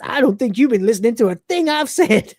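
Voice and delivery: miffed, flouncing off; offended Cat. Retold or imitated. Cat storming out misunderstanding Lister. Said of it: "he goes".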